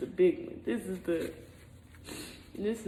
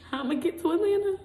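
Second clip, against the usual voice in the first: high, loud crying question; tearful despair; 9 semitones higher, 5 dB louder than usual. Real, not acted. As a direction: wail the question through tears. Crying over her stranded situation.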